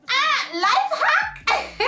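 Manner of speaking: high, loud, drawn-out exclamation; exclaimed punchline. Acted. Vlogger revealing a life hack, high gleeful exclamation.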